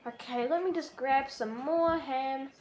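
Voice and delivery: ordinary talk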